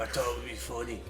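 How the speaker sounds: sheepish mumble. Retold or imitated. Caught kid excusing himself to the neighbour, meek and quiet. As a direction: mumble it ashamed at the door.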